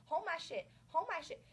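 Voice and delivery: pumping her friend up for the fight; excited urging. Retold or imitated. The friend hands off her things before the fight, loud and hyped.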